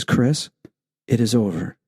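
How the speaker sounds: calm inner voice. Retold or imitated. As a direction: let it fall gently like a calming presence. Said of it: "I feel this calmness come over my body and says".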